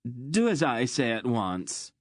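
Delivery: indignant demand; threatening. Acted. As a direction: order it like a spoiled tyrant. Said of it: "he threatened".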